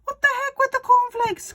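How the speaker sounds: high, loud, gleeful father; excited Mister Cranky. A narrator's reading. Father urging George on about the medicine, high and loud. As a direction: crow it like a man with a scheme. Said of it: "cried Mister Cranky".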